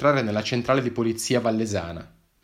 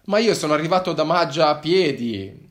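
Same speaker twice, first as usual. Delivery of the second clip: defensive claim; 5 semitones higher, 6 dB louder than usual. Acted. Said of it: "affermai poi".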